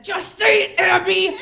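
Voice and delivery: put-on high, loud kid ordering her sister; high, loud little-girl bark at her sister; bossy child yell.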